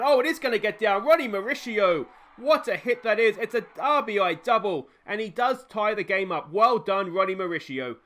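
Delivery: thrilled commentator's outburst, easing off at the end; excited play-by-play. Real, not acted. Commentator hails Ronnie's game-tying hit, excited.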